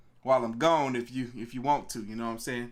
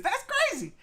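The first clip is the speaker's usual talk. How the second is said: falsetto squeal of delight; squealing over the viewer count; high squeal; 4 dB louder than usual. Real, not acted. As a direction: jump way up into a squeaky voice. Four hundred viewers, squeal.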